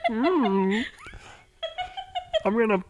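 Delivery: high, slow cartoon alien croon; leering little Martian; Marvin the Martian. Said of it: "Marvin the Martian impression".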